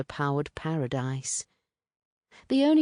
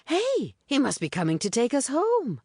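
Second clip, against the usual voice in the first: put-on higher, eager girl's voice; bright hopeful call, pitched up high; excited Puleng; 10 semitones higher than usual. A narrator's reading. Puleng eager about rescue.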